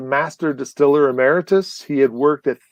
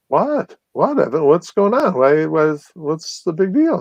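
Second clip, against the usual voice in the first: puzzled concern; 3 semitones higher than usual. Retold or imitated. Asking friend why he's upset.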